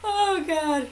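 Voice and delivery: laughing while speaking. Real, not acted. Laughing after story.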